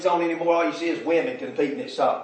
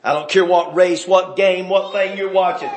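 ordinary talk, nothing marked

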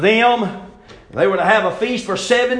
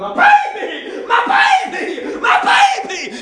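high, loud, frantic wail; anguished wailing; 5 dB louder, slower than usual. Acted. Egyptian mother running into the street, screaming high and loud. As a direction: cry out like a mother finding her child dead. Mothers finding dead firstborn.

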